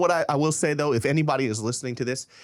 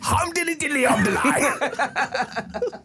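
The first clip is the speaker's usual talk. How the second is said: high, slow singsong British nonsense; laughing cartoon-British singsong; mock British accent; 11 semitones higher, slower than usual. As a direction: lilt it like a comic British musical. Answers the British musical parody.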